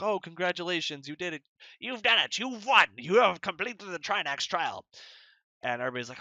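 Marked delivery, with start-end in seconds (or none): 0.0-4.8 higher, louder grand announcer voice of a wizard; booming congratulations from a trickster wizard host; Trinax the godlike wizard. Acted. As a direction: proclaim the victory like a showman god. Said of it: "and Trinax is there, and he's like".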